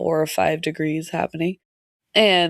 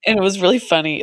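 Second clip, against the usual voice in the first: ordinary talk, nothing marked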